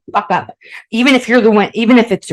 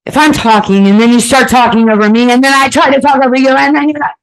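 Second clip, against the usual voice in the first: loud, raised-pitch argument demo; acted yelling; 6 semitones higher, 6 dB louder than usual. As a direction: pretend to shout over your partner without a pause. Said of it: "We're just yelling at each other".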